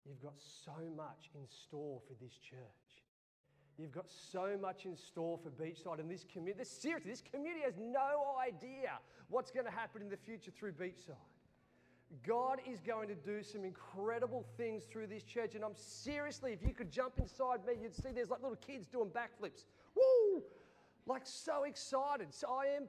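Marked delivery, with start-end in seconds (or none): 0.0-3.0 whispered, much softer surrender prayer; hushed prayer. Retold or imitated. Pastor surrendering to the Lord in a hushed prayer. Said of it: "I go". 19.9-20.4 loud, high whoop of joy; excited whoop. Real, not acted. Pastor cheering over what God will do, loud whoop.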